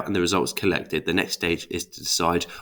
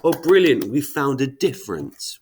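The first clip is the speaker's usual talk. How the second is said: higher, louder burst of naive triumph; mock delight; 7 semitones higher, 4 dB louder than usual. Researcher jumping to conclusions from eyeballed data, mock delight. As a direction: play a researcher far too easily thrilled. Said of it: "do you just go".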